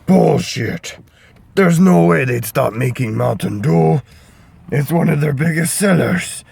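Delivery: Shrek voice. Acted. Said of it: "Shrek was like".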